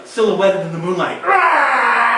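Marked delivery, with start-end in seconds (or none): 1.2-2.2 a loud animal roar from the throat; gorilla roar. Acted. A gorilla roaring at the fleeing pair, loud roar. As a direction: roar like a huge ape.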